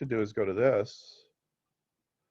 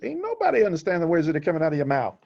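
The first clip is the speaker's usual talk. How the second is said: higher, fast, sassy comeback; Chris Tucker impression; 6 semitones higher, 4 dB louder, faster than usual. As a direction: fire it back fast and cheeky, pitched up. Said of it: "And Chris Tucker goes".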